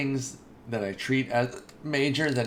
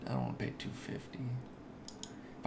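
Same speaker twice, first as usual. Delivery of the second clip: whispered; 12 dB quieter than usual. Real, not acted. Muttering about a player's price.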